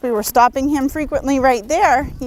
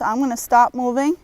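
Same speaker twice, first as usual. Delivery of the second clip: normal talking voice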